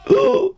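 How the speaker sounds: sudden high gasp of alarm; sharp gasp. Real, not acted. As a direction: gasp as her fighter gets hit. Fighter in trouble in game.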